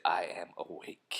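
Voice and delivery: slow, whispered drug-lord imitation. Podcaster practising his impression on co-host, slow whisper. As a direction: whisper it slowly in character.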